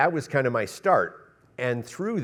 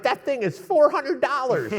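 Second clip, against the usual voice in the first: high-pitched, laughing imitation of an upset producer; hammed-up alarmed producer with a laugh; mock outraged producer; 14 semitones higher, 3 dB louder than usual. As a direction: squeal it out like a producer panicking over cost. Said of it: "the producer's like".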